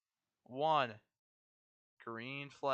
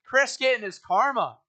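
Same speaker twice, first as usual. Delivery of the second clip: motorsport commentator; 9 semitones higher, 14 dB louder than usual. Acted. Live race crash call.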